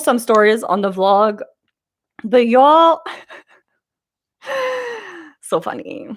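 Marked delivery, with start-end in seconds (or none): 4.5-5.0 high laugh burst. Real, not acted. Laughing at funny trip memories.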